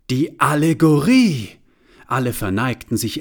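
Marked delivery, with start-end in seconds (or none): 0.1-1.5 high, loud proclamation; grand announcement. A narrator's reading. Narrator heralds the Allegory entering. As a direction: herald her arrival.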